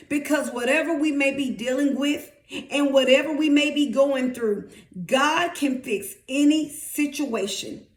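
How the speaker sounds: usual speaking voice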